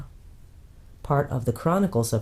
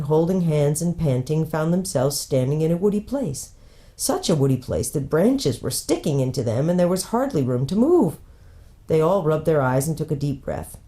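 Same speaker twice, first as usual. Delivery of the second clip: ordinary delivery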